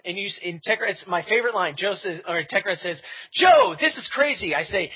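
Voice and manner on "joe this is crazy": high, loud, drawn-out disbelief; imitating a friend at wit's end; slow exclamation with the pitch pushed up; alarmed retold protest.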